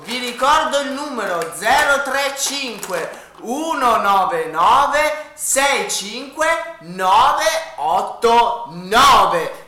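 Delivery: very slow number readout; infomercial. Acted. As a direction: drag out each digit like an ad. Repeating the phone number.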